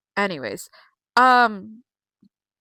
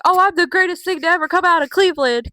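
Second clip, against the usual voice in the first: higher, louder cocky boast; a loud high mock brag from the hometown heel; mocking Logan Paul; 7 semitones higher, 7 dB louder than usual. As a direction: puff up and brag like a smug heel. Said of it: "Logan Paul was like".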